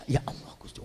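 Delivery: breathless whispered plea to God; whispered cry. Acted. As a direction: gasp it under your breath. (bisikan lirih memohon)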